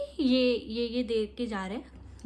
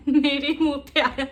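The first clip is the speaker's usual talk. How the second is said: real laughter while telling, louder; laughing; 4 semitones higher, 6 dB louder than usual. Real, not acted. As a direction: laugh through the memory. Recalls shoe hitting face. (याद करते हुए ज़ोर से हँसती हुई)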